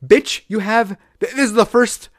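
high, loud incredulous rant; exasperated rant. Real, not acted. Venting to a friend about a TV show, high and loud. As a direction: vent at the absurd plot.

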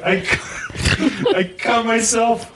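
high, slow, giggling confession; sheepish laughing kid voice; laughing through it. Retold or imitated. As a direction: squeak it out while laughing. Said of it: "I was like".

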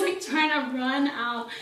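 ordinary talk

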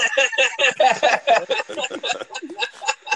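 loud high rising laugh; long laugh. Real, not acted. Friend laughing at the brother who can't help out, long laugh.

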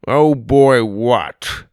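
loud, suspicious challenge; demanding. A narrator's reading. Grandpa questioning Sawyer's oh boy, loud demand. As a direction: throw the words back loudly.

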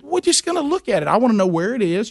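high, eager insisting; pressing his wife to go look; insistent retold self. Husband to wife, eager high insisting.

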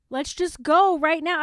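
loud, excited urging; eager outburst. Retold or imitated. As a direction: push to leave right now. Imagined impatient self.

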